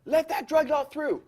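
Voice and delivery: high, slow put-on voice of the singer; mock star voice. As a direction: lightly play the rock star waving him in. Imitating the star's reply.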